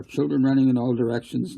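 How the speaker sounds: plain reading voice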